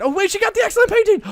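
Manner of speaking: very high, loud and quick, a sudden delighted cry; surprised joy. Real, not acted. Player spots the excellent painting, shrieking high with joy. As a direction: burst out at the long-awaited win.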